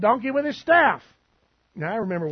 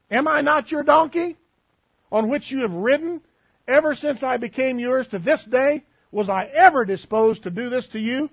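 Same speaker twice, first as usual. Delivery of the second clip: high, louder pleading donkey voice; the donkey pleading with Balaam, raised and high; 6 semitones higher, 4 dB louder than usual. Acted.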